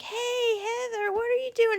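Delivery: high, sweetly eager phone greeting; fake excitement. Prankster phoning the girl upstairs, fake excitement. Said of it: "I'll be all excited and I'll say".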